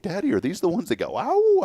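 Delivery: put-on high little-girl voice with a howl; small daughter asks daddy about wolves, high and excited; daughter's voice. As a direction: play the excited small child asking. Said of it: "She was like".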